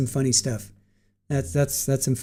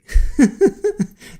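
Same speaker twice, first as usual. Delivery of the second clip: shrieking high laugh; 8 dB louder than usual. Real, not acted. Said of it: "I'm laughing so hard".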